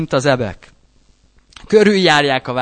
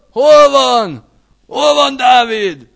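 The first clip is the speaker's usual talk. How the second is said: loud, high, slow shouting search; enemy shout; 7 semitones higher, 8 dB louder, slower than usual. Acted. Saul's men storming round the house, shouting for David. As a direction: bark it like soldiers hunting a man.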